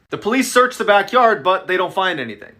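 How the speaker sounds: ordinary delivery, nothing marked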